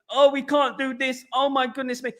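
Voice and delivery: high-pitched mock hand-wringing; mock fretting. Mocking timid excuse-makers, mock fretting.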